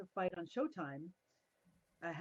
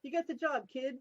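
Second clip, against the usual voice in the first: higher, louder, pleased announcement; imitating the boss; 6 semitones higher, 5 dB louder than usual. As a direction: give the good news like a proud boss. Boss announcing she's hired.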